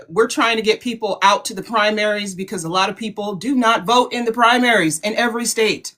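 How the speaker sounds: normal talking voice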